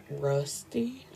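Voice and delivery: whispered Rusty, doubtful; skeptical whisper. Pretend. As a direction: breathe the name out in disbelief. Mocking the guy's name.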